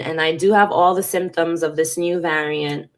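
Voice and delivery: ordinary delivery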